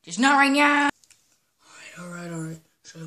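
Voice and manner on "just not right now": loud. Acted. Link snaps it aloud at himself. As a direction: burst out louder and higher, punching right. Same words said twice.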